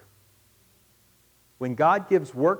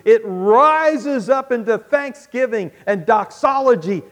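building louder and higher toward praise; swelling, fervent pulpit build; rising preaching; 6 semitones higher, 10 dB louder than usual. Acted. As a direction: lift them up into worship.

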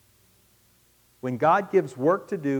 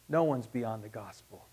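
quiet, lower repeat of the line; hushed, intimate restatement; soft echo; 4 semitones lower, 5 dB quieter than usual. Real, not acted. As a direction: let it sink in gently.

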